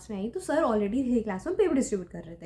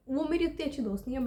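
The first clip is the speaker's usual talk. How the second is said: ordinary delivery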